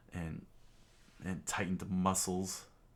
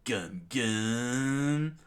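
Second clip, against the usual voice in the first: loud, higher cry, rising and stretched; attack call; 4 semitones higher, 5 dB louder than usual. Acted. Luffy stretches his arm out, calling his attack. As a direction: call out the move, winding up.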